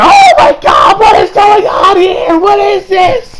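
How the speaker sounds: hammed-up high, loud, drawn-out panic; freaking out. Retelling a character seeing his hands reappear, freaking out. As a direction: play a full freak-out, stretching the words in a high shout. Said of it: "so he's kinda freaking out".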